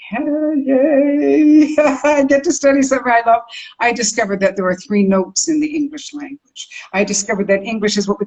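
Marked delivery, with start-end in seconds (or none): 0.1-3.4 high, gleeful cheer with a laugh; thrilled at getting to study jazz; excited. Retold or imitated. Recalling her delight at studying jazz, gleeful. Quoted own joy at jazz.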